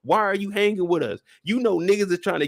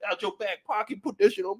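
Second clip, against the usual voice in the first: ordinary talk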